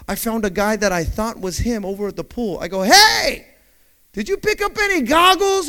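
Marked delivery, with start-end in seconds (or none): 2.8-5.7 calling out. Retold or imitated. Asking the guy at the pool.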